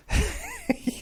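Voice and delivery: short high laugh; high giggle. Real, not acted. Laughs about fame.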